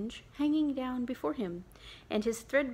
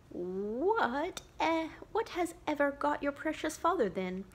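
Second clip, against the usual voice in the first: lightly voiced anxious mother, higher; a mother wondering aloud where father is; a hint of a fussing mother; fretting mother; 6 semitones higher than usual. Mother fretting over late father.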